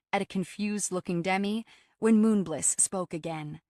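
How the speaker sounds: plain reading voice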